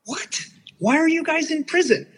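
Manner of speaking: stunned, rising to a squeak; shocked disbelief. Retold or imitated. Hearing his friends are in prison, shocked and high. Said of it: "I'm like".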